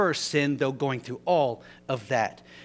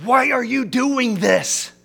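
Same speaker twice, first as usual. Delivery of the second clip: loud, high, slow demand; indignant yell; 11 semitones higher, 12 dB louder, slower than usual. Retold or imitated. Angry driver yelling at Dudley, loud, high, slow. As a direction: throw the question hard at him. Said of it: "He said".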